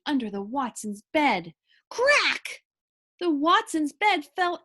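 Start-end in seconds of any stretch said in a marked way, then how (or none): 1.9-2.6 cracking sound effect. A narrator's reading.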